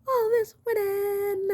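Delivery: very high, sustained diva voice. Acted. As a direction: hold it out high and showy. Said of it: "In my [name] voice".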